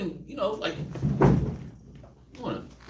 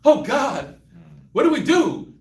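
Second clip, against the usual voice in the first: rising panic, getting louder; panicked disciples; 5 dB louder than usual. Retold or imitated. Disciples on the boat crying out in panic. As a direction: cry out in fear of the storm. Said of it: "They're like".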